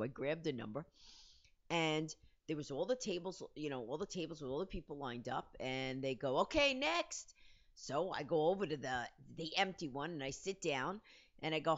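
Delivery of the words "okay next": lightly imitated call to a waiting queue. Casting agent to the waiting line, mock calling out. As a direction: raise the pitch as if summoning someone.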